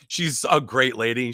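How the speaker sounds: ordinary talk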